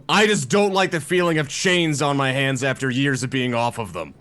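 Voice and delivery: loud, high shout down the hall; shouted after him. Acted. Prisoner shouts after the fleeing guard, loud. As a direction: call after him loudly. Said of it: "can I shout after him".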